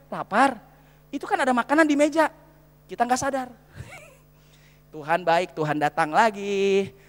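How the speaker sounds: ordinary talk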